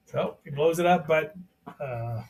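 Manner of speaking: normal talking voice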